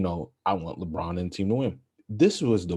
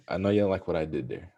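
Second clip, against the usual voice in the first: ordinary talk, nothing marked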